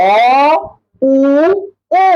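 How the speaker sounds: vowels with lips made round. Shown as a demonstration. Teacher demonstrating rounded vowels. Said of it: "মনে করে দেখেন ঠোঁটগুলা গোল".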